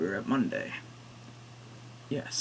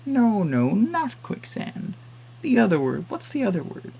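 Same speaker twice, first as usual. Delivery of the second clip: put-on old woman; 8 semitones higher, 4 dB louder, slower than usual. Old woman corrects husband.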